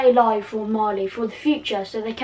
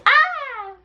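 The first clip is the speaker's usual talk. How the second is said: high shrill scream in his face; piercing hammed-up scream; villain scream. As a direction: shriek at him like a mad witch. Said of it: "Bellatrix screaming in his face".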